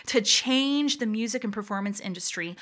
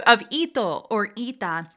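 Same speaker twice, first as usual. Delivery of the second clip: ordinary delivery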